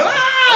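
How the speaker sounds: very high, loud crowd scream; hammed-up shriek; panicked mock scream. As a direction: scream like freaking-out townsfolk, half laughing. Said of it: "like, freak out".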